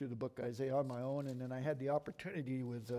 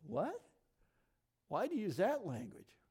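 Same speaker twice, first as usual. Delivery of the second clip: higher-pitched, puzzled questioning; mock surprise, pitched up; puzzled reader; 6 semitones higher than usual. Preacher voices a reader questioning the text, puzzled.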